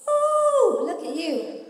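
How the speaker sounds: high admiring ooh, then teasing. Shown as a demonstration. Talking to yourself in the mirror, playfully admiring. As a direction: swoon at your own reflection. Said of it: "talk to yourself like that".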